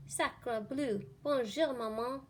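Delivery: chirpy French duckling greeting; baby duck. Acted. Newborn duckling greets Jack in a little French voice. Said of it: "and said".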